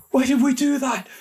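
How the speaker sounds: very high breathless question; panicked. A narrator's reading. Boy running from the Banshee, high panicked question. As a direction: gasp it out while fleeing. Liam voiced.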